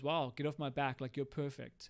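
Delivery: ordinary talk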